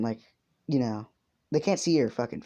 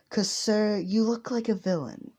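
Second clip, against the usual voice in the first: higher, formal hero explaining himself; higher-pitched earnest hero voice; 8 semitones higher than usual. Acted. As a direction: play the earnest hero warning a suspect. Hero accuses Mono.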